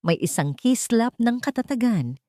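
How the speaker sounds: ordinary delivery, nothing marked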